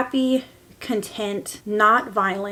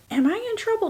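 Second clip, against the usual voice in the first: put-on child's worry; 12 semitones higher, faster than usual. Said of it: "I felt like".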